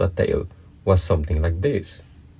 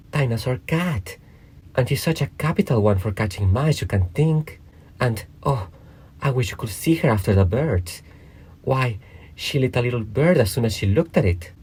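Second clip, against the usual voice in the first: put-on high, girlish chatter with mild enthusiasm; eager; 6 semitones higher than usual. A narrator's reading. Alice talks about her pet cat, high and eager. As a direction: tell it keenly in a raised, light voice. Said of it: "Alice replied eagerly".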